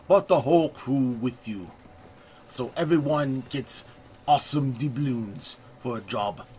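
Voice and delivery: playful, hammed-up French accent narrating the pirate adventure; a self-confessed terrible French accent, overplayed for laughs; mock French accent. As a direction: put on a deliberately bad French accent, like a cheesy pirate guide. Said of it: "I have a terrible French accent".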